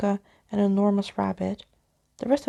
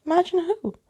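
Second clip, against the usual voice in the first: puzzled question; 9 semitones higher, 4 dB louder than usual. Acted. Big Brother's puzzled question.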